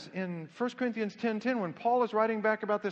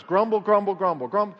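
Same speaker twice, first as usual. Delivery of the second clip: punchy, loud "grumble" repeated as a model; loud demonstration; 4 dB louder than usual. Shown as a demonstration. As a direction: chant it briskly and loudly. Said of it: "In fact, very loud".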